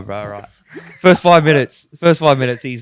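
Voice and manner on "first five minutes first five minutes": loud emphatic. Real, not acted. Hyped storytelling opener.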